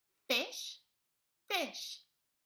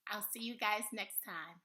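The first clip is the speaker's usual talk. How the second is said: ordinary delivery, nothing marked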